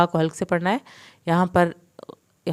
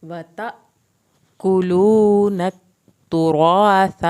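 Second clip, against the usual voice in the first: slow, louder, drawn-out melodic tajweed recitation; Quran recitation; 9 dB louder, much slower than usual. Shown as a demonstration. Teacher reciting the full verse for students, slow melodic recitation. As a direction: chant it out full and slow, stretching the long vowels.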